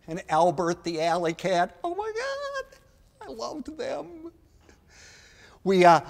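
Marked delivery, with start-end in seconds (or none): 1.8-4.3 high, breathless gush of delight. Retold or imitated. Remembering childhood mascots, high gushing delight. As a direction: squeal it like a starstruck kid.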